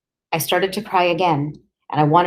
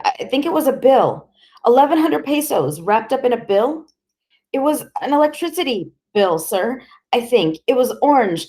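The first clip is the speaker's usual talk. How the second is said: frightened answers; 7 semitones higher than usual. Acted. Frightened boy answering fast.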